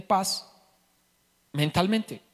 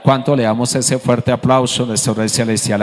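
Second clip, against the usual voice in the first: ordinary delivery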